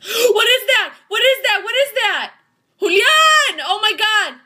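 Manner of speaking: high, loud shrieks of alarm; panicked screaming. Real, not acted. Player spots something in the woods, panicked screaming.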